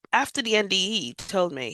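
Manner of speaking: ordinary talk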